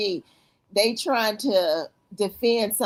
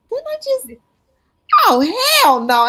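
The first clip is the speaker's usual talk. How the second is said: retold shock; 8 dB louder than usual. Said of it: "I'm like".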